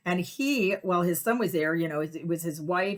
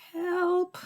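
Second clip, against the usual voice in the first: mock plea; 13 semitones higher than usual. People silently pleading.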